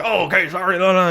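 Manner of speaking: high flustered apology; flustered Martin Short. Retold or imitated. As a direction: hurry out an embarrassed apology. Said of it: "he's like".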